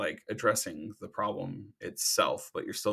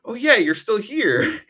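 mock surprise; 12 semitones higher, 9 dB louder, slower than usual. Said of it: "And you're just like".

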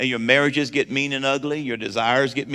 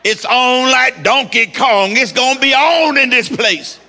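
booming ring announcer hype; wrestling announcer voice; 9 semitones higher, 7 dB louder, slower than usual. Acted. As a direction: roar it like a WWE ring announcer. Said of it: "that W W E wrestling voice".

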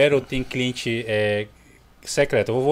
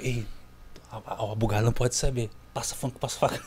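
furtive whisper; 7 semitones lower, 12 dB quieter than usual. Acted. Dealer scene acted out.

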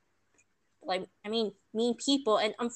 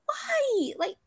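much higher, crying-edged why; betrayed by the instructor's report, higher and tearful; near-tears protest; 11 semitones higher than usual. Retold or imitated. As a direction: plead through tears of frustration. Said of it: "And I'm just like".

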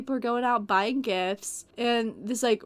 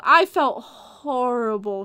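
ordinary delivery

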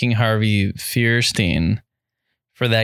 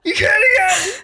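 much higher, breaking into loud laughter; laughing; 3 dB louder, faster than usual. Real, not acted. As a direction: crack up while saying it. Friend attempts the impression.

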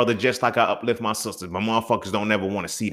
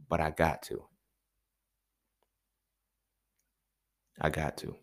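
resigned murmur; 5 semitones lower, 10 dB quieter than usual. Real, not acted. Resigned trailing admission.

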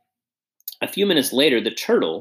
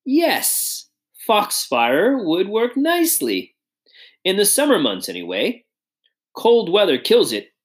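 put-on genial old statesman; 8 semitones higher than usual. Statesman praises the foxfire idea, higher and musing. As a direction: savour the idea like a wise elder.

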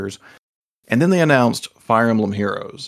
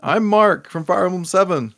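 heartbroken plea; 9 semitones higher, slower than usual. Retold or imitated. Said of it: "I felt a little heartbreak".

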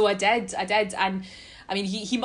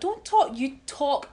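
scoffing retort, louder at the end; mock disbelief; 3 semitones higher, 7 dB louder than usual. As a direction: act out people rubbishing the claim, mildly heated. Retold disbelieving reply from others.

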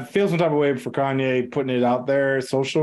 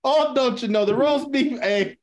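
higher, hammed-up Canadian accent; mock Canadian accent; 9 semitones higher than usual. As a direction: put on a sing-song Canadian accent. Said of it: "what's a Canadian accent?".

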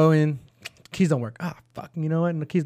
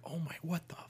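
much softer, stunned; clerk's hushed stunned curse; shocked under breath; 2 semitones higher, 16 dB quieter than usual. Retold or imitated. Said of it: "her face is, like, shocked".

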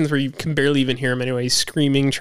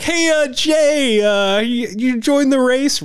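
slow, higher mock reporter; lightly imitating a clueless reporter; 7 semitones higher, slower than usual.

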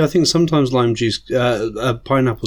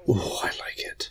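whisper; 8 semitones lower, 12 dB quieter than usual. Real, not acted. Tasting the new drink.

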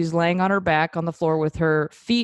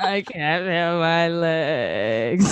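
very slow, crying whine; whiny voice; much slower than usual. Retold or imitated. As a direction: drag it out like a weepy drunk. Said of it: "very whiny voice".

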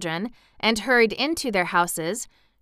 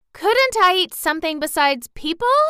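put-on high, louder child's plea; pleading little girl; 10 semitones higher, 5 dB louder than usual. Hungry girl asking townspeople for food, pleading. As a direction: ask it like a hungry child begging.

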